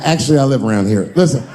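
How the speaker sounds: usual speaking voice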